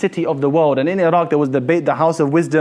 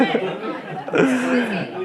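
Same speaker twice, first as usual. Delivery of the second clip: amused laugh, higher and softer; real laugh; 4 semitones higher, 6 dB quieter than usual. Real, not acted. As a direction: laugh helplessly at your own joke. Laughing at the lookalike joke.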